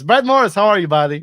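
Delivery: high, loud name call; excited greeting. Real, not acted. Host greets guest.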